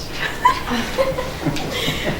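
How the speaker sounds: laugh. Real, not acted. Laughs after own pun.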